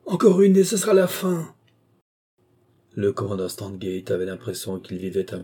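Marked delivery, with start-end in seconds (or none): 0.0-1.5 high, loud raving shout; gloating over their coming fall; madman's cry. Acted. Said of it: "criait le fou".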